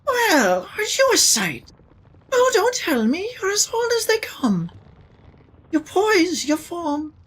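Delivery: put-on high, sing-song old seamstress; lilting elderly seamstress voice. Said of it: "what's a good Seamstress voice".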